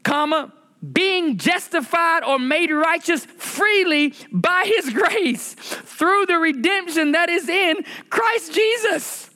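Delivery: high, stretched sermon delivery; preaching at full force. Acted. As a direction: lift it up like a pulpit climax. Peak of sermon, high pitch.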